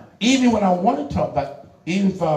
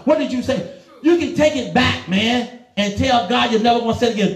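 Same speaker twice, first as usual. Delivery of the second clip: ordinary delivery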